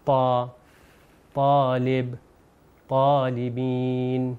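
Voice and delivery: "پا، طالب، طالبین" with a heavy ط and long drawn vowels; thick emphatic Arabic ط. Shown as a demonstration. Teacher showing viewers the Arabic letter, "پا، طالب، طالبین" with thick ط. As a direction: say "پا، طالب، طالبین" thickly, tongue behind the upper teeth. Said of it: "مگر موٹا اس کو تلفظ".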